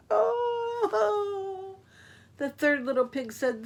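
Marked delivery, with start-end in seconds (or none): 0.1-1.7 high, hammed-up sob; mock crying wolf. Wolf crying on a log, in a hammed-up high sob. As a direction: play the wolf's sob big and silly. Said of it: "He began to cry".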